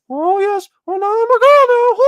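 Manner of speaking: cartoonish high-pitched mockery of a guy claiming he's a girl; mocking squeaky voice. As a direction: ham up a squeaky Mickey Mouse girl voice. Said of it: "That's how he talked".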